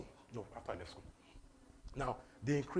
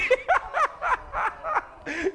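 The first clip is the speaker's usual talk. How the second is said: high, loud cackling laugh; real burst of laughter; 9 dB louder than usual. Real, not acted. Bursts out laughing mid-talk.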